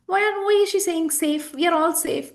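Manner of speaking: puzzled objectors. Acted. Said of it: "people will say".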